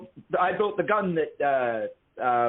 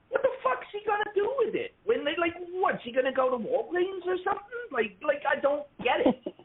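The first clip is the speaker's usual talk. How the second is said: high, incredulous ranting; exasperated rant; 10 semitones higher than usual. Real, not acted. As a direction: demand an answer in disbelief. Ranting about Land of the Dead.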